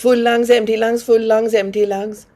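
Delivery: rapid, run-together rhythmic cue; quick breath count. Real, not acted. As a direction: drive a brisk in-out breathing rhythm. Cues the sped-up bellows breathing rhythm.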